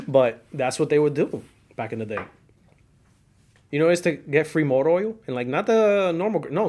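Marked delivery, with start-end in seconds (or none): none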